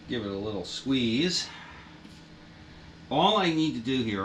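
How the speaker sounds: normal talking voice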